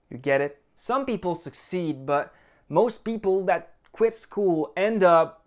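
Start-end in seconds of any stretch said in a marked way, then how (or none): none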